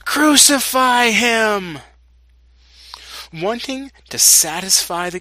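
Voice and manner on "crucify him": loud, high, drawn-out demand; insistent crowd shout. A narrator's reading. The crowd shouts back at Pilate's question, insistent. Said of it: "they shouted all the louder".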